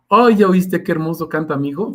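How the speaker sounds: proud parent. Retold or imitated. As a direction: show off your kid's singing to everyone. Proud parent praising singing child. (padre orgulloso presumiendo, más agudo y fuerte)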